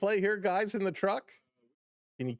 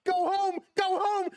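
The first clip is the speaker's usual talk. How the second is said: high, pleading cheer; will him home with your voice; urging go home; 12 semitones higher than usual. Real, not acted. Streamer urging Springer to run home, excited.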